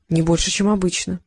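ordinary delivery, nothing marked

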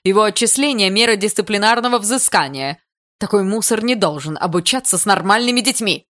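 loud, high-pitched indignant complaint; indignant mother laying down charges; angry accusation. Acted. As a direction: denounce him loudly, full of outrage.

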